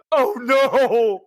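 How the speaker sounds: high, loud cry of dismay; mock dismay. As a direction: wail it in comic horror. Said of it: "he's just".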